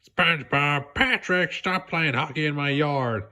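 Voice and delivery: a mild, nasal-free grumpy call; mock yell at the neighbours; lightly voiced angry neighbour. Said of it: "he yelled".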